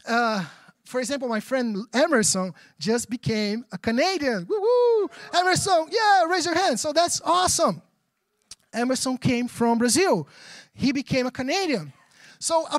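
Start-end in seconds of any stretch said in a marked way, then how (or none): 4.5-7.8 high-pitched, delighted congratulations; whooping, higher-pitched shout-out; celebratory cheer. Real, not acted. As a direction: cheer on a friend in front of a crowd. Cheers a friend's new citizenship.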